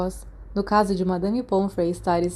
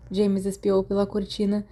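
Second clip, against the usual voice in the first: ordinary delivery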